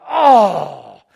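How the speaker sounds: loud, drawn-out "oh"; struck outcry. Retold or imitated. Pastor struck by God's word, loud cry. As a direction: cry out as if hit by it.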